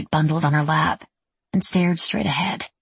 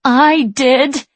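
sharp, loud, high retort; shot back angrily; 8 semitones higher, 7 dB louder than usual. A narrator's reading. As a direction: snap it back through tears. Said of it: "she shot back".

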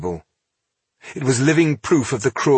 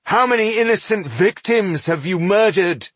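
high, forceful demand; outraged accusation; 11 semitones higher, 4 dB louder than usual. A narrator's reading. Elf accuses dwarf of murdering innocents, outraged.

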